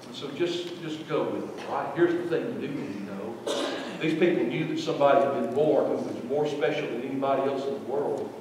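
ordinary talk, nothing marked

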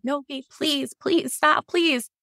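high, pleading mock sob; crying plea. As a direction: beg as if in tears. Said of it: "Serge was, like, crying".

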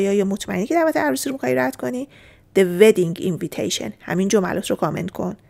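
ordinary talk

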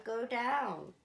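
ordinary delivery

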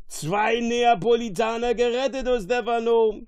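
high, loud rejoicing; jubilant. A narrator's reading. Fool to his friend, both saved from shipwreck, jubilant. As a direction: exult at being saved.

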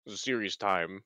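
low, deepened mock-serious voice. Changing voice for a no-laughing challenge, serious voice. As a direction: drop your voice to sound serious. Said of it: "I'm gonna change my voice now".

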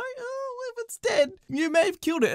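high whiny customer voice; lightly voiced pleading customer; mock customer. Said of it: "imitating a customer".

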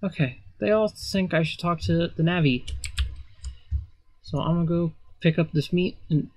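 ordinary talk, nothing marked

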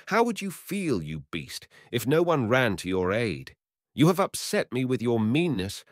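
high, reproachful hunter; scolding hunter. Acted. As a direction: tell him off.

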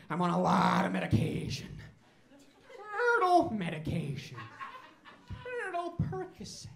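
high, loud silly turtle puppet voice; Turdy the turtle. Acted. Turtle guest addresses the comedy audience, high silly voice. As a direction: play a goofy, dazed turtle guest. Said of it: "put your hands together for Turdy".

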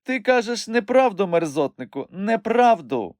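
indignant accusation. A narrator's reading. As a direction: insist indignantly, pitched high. Accusing him in playful quarrel.

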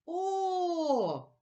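ооо, stretched for the class to hear; isolated vowel sound. Shown as a demonstration. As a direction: sing out a long, steady О. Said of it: "Чуємо звук О".

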